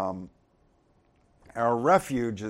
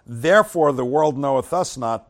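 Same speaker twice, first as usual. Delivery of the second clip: scripture reading; 2 semitones higher, 9 dB louder than usual. Acted. Preacher reading First John aloud to the church, solemn and loud. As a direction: read out the verse loud and solemn.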